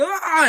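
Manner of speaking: high, loud comic "ah"; hammed-up cry of alarm; mock alarmed yell. Viewer watching the fight, mock alarmed yell.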